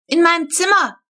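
put-on high, loud eager child's shout. A narrator's reading. Flo claims the raccoons for her room, high loud shout. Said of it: "brüllte Flo".

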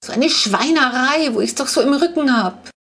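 a hint of a high, slowish complaining granny; lightly voiced old woman lamenting; old woman whining. A narrator's reading. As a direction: grumble on like an aching old lady. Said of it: "jammert die alte Frau".

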